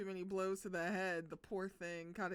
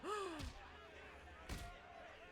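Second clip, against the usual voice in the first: a long, soft, unvoiced shocked gasp; breathy shocked gasp; 9 semitones higher, 11 dB quieter than usual. Real, not acted. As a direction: gasp in horror, barely audible. Reacting to the beating.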